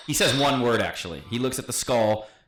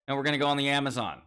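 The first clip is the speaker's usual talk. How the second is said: ordinary delivery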